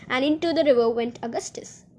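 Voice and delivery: plain reading voice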